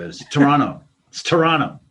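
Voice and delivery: "Toronto" with the t dropped, like "Toranno"; Toronto said locally. Retold or imitated. Hecklers correcting the comedian, loudly saying "Toronto" the local way. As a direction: say "Toronto" loud, clipped to "Toranno". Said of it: "heckled for calling it Toronto".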